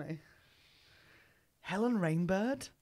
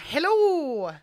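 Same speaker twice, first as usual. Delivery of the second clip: lightly put-on Irish accent greeting passengers; mock Irish accent; 6 semitones higher, 3 dB louder than usual. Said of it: "Oh, Ryanair".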